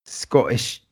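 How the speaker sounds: low, badly faked Scottish accent; terrible Scottish accent. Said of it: "That was a terrible Scottish accent".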